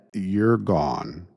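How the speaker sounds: ordinary delivery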